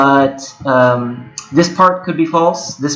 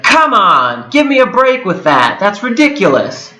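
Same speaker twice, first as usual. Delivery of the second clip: higher, exasperated brush-off; imitating an annoyed arguer, pitched up; mock exasperated retort; 7 semitones higher than usual. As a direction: toss it back like a heated opponent. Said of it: "We were yelling at each other".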